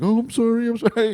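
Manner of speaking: high, quick apologetic voice; flustered stranger. Retold or imitated. Stranger backing away from the dog, high flustered apology. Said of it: "He's like".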